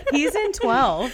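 loud, drawn-out words through laughter; laughing. Real, not acted. Host to friends cracking up over a quoted line, laughing loudly. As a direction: crack up as you say it.

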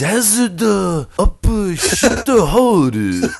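very slow, high, hammed-up mock Japanese accent. Asked to say it in Japanese, friend answers in a hammed-up mock Japanese accent. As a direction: stretch it out in a jokey fake Japanese accent. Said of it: "how do you say jazzed up shithole in Japanese?".

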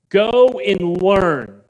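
loud, slow, drawn-out order; Jesus ordering the Pharisees to go learn; stern command. Acted. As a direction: plant each word like a command.

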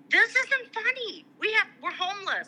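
high-pitched retold plea, imitating upset crying; tearful protest. Eighteen-year-olds pleading with her laughing dad, mock tearful protest. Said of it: "and we're crying".

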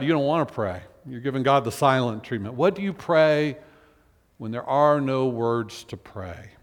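normal talking voice

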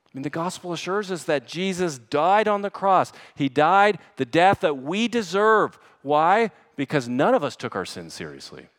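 loud, high, urgent pulpit exhortation; forceful preaching. Real, not acted. Preacher urging the church to fight sin, forceful.

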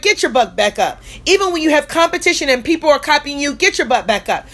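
punchy refrain, each one driven home; booming motivational speech. Acted. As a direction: hammer the refrain like a preacher.

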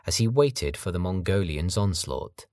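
plain reading voice

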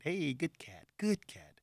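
soft, coaxing pet talk; wary cat-petting voice. Acted. Animal hater petting the family cat, soft coaxing. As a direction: coo gingerly to a cat, softly. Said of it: "I'll pet it".

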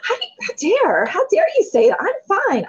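gasp, then high indignant outburst; hammed-up outrage, dragging out the first words; mock offended. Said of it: "I was so offended".

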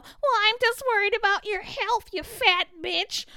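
high, fake-sweet mock voice, slowing on the insult; mocking concern troll. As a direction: parody a fake-caring critic. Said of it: "Bitches always wanna talk about".

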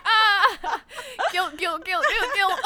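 high, loud laughing chant; laughing mock guilt. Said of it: "So we were like".

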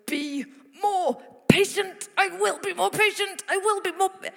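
high, loud, forced self-command; strained determination. Acted. Preacher mimics someone forcing themselves to be patient, strained and high.

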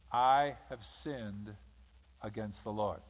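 low, slow, soft repentance; humble confession. Retold or imitated. David confessing his sin to Nathan, humbly. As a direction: confess it quietly and sincerely.

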